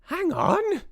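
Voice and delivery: very high witch squeal; crying out. A narrator's reading. Mildred stops the others, very high cry. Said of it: "cried the witch called Mildred".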